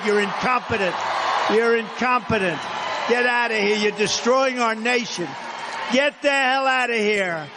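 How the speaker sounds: high, slow crowd-pleasing yell; mock firing shout. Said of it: "we should be saying".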